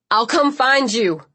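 calling out as he backs away; shouting. A narrator's reading. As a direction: shout after her. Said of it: "Liam was shouting".

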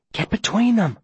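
high-pitched, urgent order; a voiced hiss, pushed up high in urgency. A narrator's reading. Viola urging Todd to step between them, hissed. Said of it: "Viola hisses at me".

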